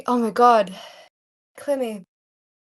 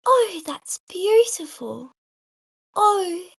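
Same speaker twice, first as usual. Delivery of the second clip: high, drawn-out dainty character voice; slow, lilting, higher-pitched impression; Clemmy; 8 semitones higher, slower than usual. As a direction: coo it slowly, pitched up high. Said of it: "Clemmy is like".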